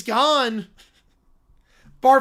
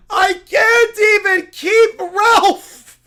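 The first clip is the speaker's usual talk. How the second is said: loud, half-laughing preacher rant; a zealot laughing as he rants; laughing zealot shout; 9 semitones higher, 11 dB louder, much slower than usual. Acted. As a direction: scold him like a furious cult leader. Character despair.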